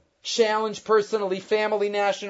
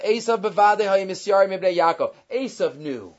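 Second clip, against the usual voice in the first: ordinary talk, nothing marked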